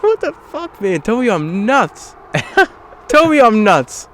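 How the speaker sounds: high, loud laughing incredulity; laughing, half-shouting in disbelief. Retold or imitated. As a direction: cackle through it in disbelief.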